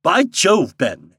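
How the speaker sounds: high, loud, hearty greeting; lightly acted delight; pleased exclamation. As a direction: greet a stroke of luck. Delighted employer greets the guide.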